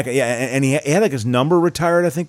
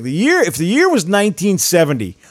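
high, louder radio movie-review voice; regular guy movie reviewer; 7 semitones higher, 4 dB louder than usual. Retold or imitated. Imitating the regular guy's radio review, announcer voice. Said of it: "I remember him reviewing".

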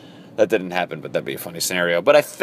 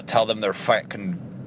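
normal talking voice

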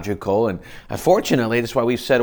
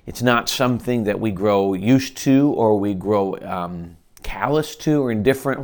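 normal talking voice

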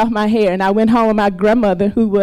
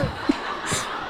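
breathy laugh; 14 dB quieter than usual. Real, not acted. Laughing at her own story.

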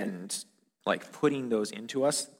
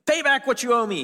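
sharp high shouted demand; a furious servant yelling for his money; angry demand; 14 semitones higher, 8 dB louder than usual. Acted. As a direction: roar it at him, choking him. Servant choking fellow, demanding.

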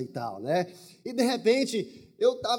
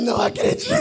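louder, laughing disbelief; disbelieving laugh; 12 semitones higher, 8 dB louder, faster than usual. Retold or imitated. Hearing she knew his name, louder laughing disbelief. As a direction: laugh in happy shock.

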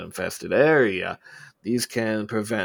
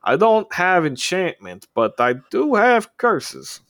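much higher and slow, savouring it; sly reveal; 9 semitones higher, 6 dB louder, slower than usual. Acted. Shopkeeper offering curses to a customer, sly and slow. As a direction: tease out the curses slowly, pitched up.

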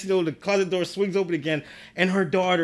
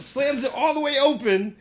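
ordinary talk

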